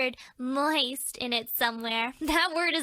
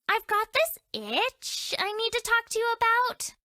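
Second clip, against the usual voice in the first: ordinary talk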